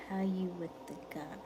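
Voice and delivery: reading the question in a whisper. Shown as a demonstration. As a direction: whisper the viewer's question to yourself. Reads chat question twice.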